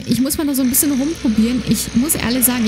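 normal talking voice

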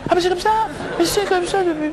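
very high, agitated comic cry; high-pitched outburst. Acted. Poor man protesting his lot, high-pitched outburst. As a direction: burst upward into a strained high complaint.

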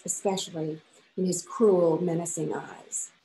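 ordinary delivery

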